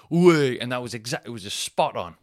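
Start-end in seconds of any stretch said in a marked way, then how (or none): 0.1-0.6 loud, higher "Way"; mock lad shout. Wife mimicking the lad, loud shout. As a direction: bellow it like the lad. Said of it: "that's a wonderful sentence".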